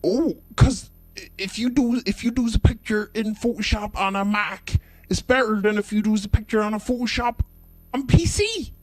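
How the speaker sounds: high, loud, protesting rustic voice; hammed-up shrieking customer; insistent yokel customer. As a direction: shout him down in a squeaky country voice. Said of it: "And he said".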